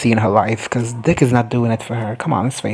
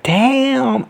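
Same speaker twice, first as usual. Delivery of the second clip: very high, stretched exclamation; mock stunned reaction; 13 semitones higher than usual. Woman reacting to a thrown rat, mock stunned. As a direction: react in a high, impressed wail. Acting out reaction to being hit.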